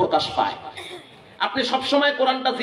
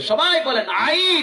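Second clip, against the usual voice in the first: drill call; 4 semitones higher, slower than usual. Real, not acted. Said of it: "leading the crowd in a drill".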